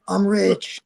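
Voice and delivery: high, delighted little boast; playfully thrilled; giddy joy. Retold or imitated. A young fighter paid a hundred bucks, high giddy boast.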